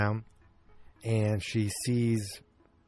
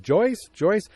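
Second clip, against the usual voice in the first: raised, higher searching call; 8 semitones higher, 7 dB louder than usual. Retold or imitated. Man outside looking for Joyce, calling out. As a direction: call her name as if across a yard.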